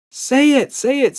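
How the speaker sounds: high loud excited squeal. A narrator's reading. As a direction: squeal it eagerly. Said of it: "squealed Emma".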